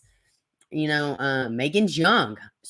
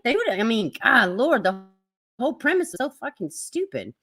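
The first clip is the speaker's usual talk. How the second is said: ordinary talk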